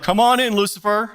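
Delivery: high, loud cheery welcome; mock welcome. Preacher mocks welcoming Lucifer, cheery. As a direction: invite him in with mock cheer. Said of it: "open up the door and be like".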